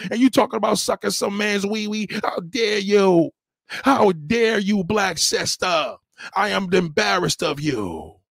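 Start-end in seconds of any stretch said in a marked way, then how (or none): 2.2-6.0 slow outrage. Real, not acted. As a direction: stretch each word in disbelief.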